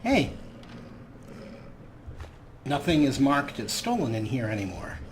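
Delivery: ordinary talk, nothing marked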